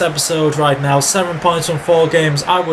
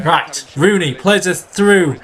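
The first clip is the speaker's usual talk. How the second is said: normal talking voice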